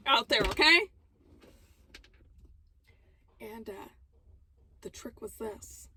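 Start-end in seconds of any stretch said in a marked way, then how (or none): none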